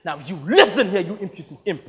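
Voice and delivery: loud, indignant tirade; fuming Mister Coyote. Acted. Offended actor lashing out at the person who slighted him, fuming. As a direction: tear into someone who insulted your craft. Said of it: "leaving me with a fuming Mister Coyote".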